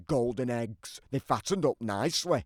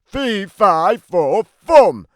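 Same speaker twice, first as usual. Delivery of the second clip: loud, high booming chant; giant yell; 6 semitones higher, 11 dB louder, much slower than usual. Acted. Giant yelling for Jack the second time, booming. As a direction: yell it to shake the rafters. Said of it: "I yelled".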